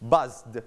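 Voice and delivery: "buzzed" in one syllable, buzzed Z into a released D; voiced D ending. Shown as a demonstration. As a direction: finish "buzzed" on a voiced D after the buzz. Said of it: "you pronounce the". (ออกเสียง "buzzed" จบด้วยเสียง ด ต่อจากเสียง Z)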